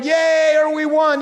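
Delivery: imitated celebration shout; mock fan shout. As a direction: cheer it like a sports fan. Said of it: "you wouldn't have been shouting".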